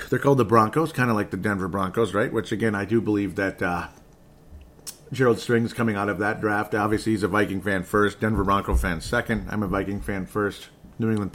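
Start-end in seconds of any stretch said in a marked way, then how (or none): none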